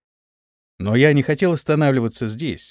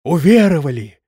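crowd echoing the cry; 3 semitones higher, 6 dB louder than usual. A narrator's reading. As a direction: echo the preacher resoundingly. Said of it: "И все повторили". (громкий ответный выкрик толпы)

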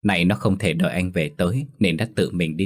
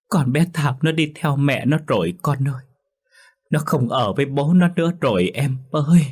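higher, sobbing speech; crying while speaking; 7 semitones higher than usual. A narrator's reading. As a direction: speak through tears, sniffling. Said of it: "chỉ khóc lóc rồi sụt sịt nói".

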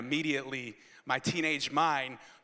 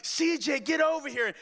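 higher urgent call; calling the dog; 9 semitones higher, 3 dB louder than usual. Retold or imitated. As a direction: call the dog in rising panic. Calling dog during UFO scare.

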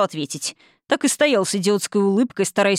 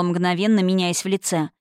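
usual speaking voice

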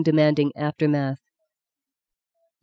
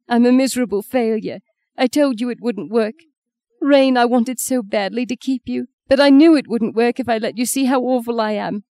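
tearful; 5 semitones higher than usual. A narrator's reading. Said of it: "she said between sobs".

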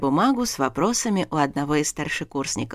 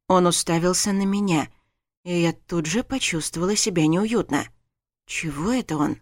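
usual speaking voice

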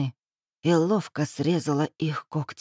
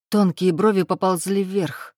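usual speaking voice

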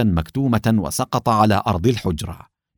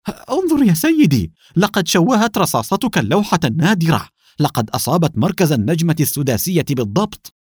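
alarmed; 6 semitones higher, 3 dB louder than usual. Acted. Henchman cries out in alarm.

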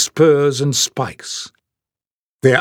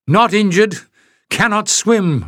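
breathless, higher, clipped out between breaths; panting; 7 semitones higher than usual. Acted. Leif panting an answer to Pen mid-swim, breathless and raised. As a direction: gasp it out while swimming. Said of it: "Leif panted".

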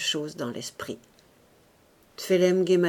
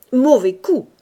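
loud, raised call of foul; repeated protest; 5 semitones higher, 10 dB louder than usual. A narrator's reading. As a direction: insist on the foul, voice up. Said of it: "Lord Barnard répétait". (protestation répétée à voix forte)

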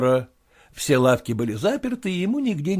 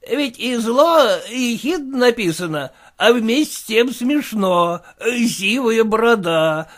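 higher, aggrieved merchant voice; aggrieved friend; 7 semitones higher, 4 dB louder than usual. Acted. Goldatin tells Yegor he is hurt, aggrieved. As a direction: sound stung but not angry.

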